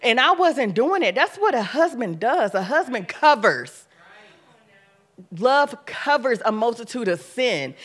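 firm sermon emphasis; preaching. Real, not acted. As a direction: drive the point home to the congregation. Preaching point about husband covering.

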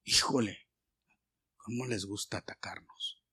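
whispered aside; rueful whispered aside. Real, not acted. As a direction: hush it to the congregation. Aside about attackers.

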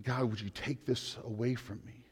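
soft, pleading prayer to God; quietly voiced Jesus praying; hushed prayer. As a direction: murmur it like a desperate plea. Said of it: "overwhelming him in prayer".

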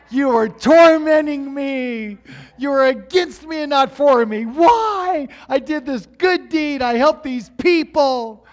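loud, high cry of protest; hammed-up wounded outrage, shouted; outraged accusation. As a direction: rail at heaven, demanding why. Retold angry accusation against God.

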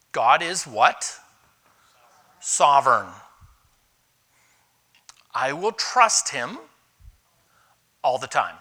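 slow, loud declaration of faith; preaching declaration. Acted. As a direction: proclaim it slowly, word by word. Preaching the theme.

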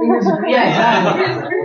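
laughing agreement; amused laughing yes. Real, not acted. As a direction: chuckle through the words. Laughing at listener's quip.

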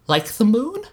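high small voice after crying; sniffly little girl. Acted. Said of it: "she asked with a sniff".

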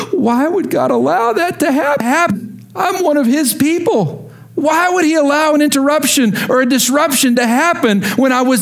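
high, complaining voice of a hurt believer; mock-plead like a wounded believer; whiny believer. Preacher mocking believers who complain to God, high and whiny. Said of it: "believers that go".

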